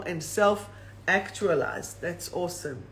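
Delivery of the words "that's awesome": ordinary talk, nothing marked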